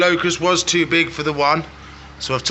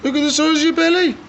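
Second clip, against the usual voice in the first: much higher and louder, marvelling at the belly; amazed exclaim; 12 semitones higher, 5 dB louder than usual. Real, not acted. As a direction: gasp in delight at how big it got.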